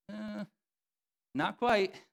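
sarcastic teacher's put-down. Retold or imitated. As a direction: puncture their overconfidence gently. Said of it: "he uses sarcasm".

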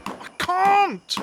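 high, anguished cry; desperate refusal. Acted. Terrified informer refusing to name his boss, desperate.

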